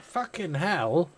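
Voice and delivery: put-on high, slow startled child exclamation; child's shocked swear. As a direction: mimic a little kid blurting a swear word. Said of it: "and she went".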